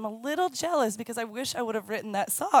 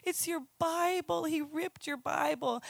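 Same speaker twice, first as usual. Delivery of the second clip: put-on higher, soft, slow, pleading child; upset little girl; 6 semitones higher, 5 dB quieter, slower than usual. Daughter protesting to mommy about her ripped Bible, soft and pleading. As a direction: plead like a hurt five-year-old. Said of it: "She's like".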